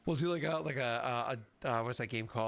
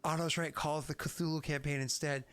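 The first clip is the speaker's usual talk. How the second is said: ordinary talk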